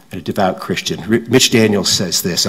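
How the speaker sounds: normal talking voice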